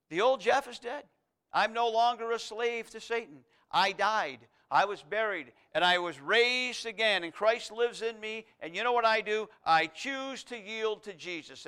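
loud, rising declaration of faith; full-force preaching. Acted. Preacher voicing a believer answering temptation, loud and bold. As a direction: declare it boldly, slowing at the end.

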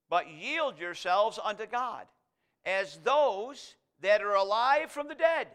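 slow, swelling scripture reading; preaching scripture. Acted. Preacher reading verse thirteen to the church, slow and swelling. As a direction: stretch each word out for weight.

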